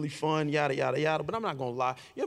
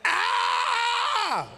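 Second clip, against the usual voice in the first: high loud reenacted scream; scream for help; 3 dB louder than usual. Screaming for his brother on the couch. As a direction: yell out so someone comes to help. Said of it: "if I scream loud enough".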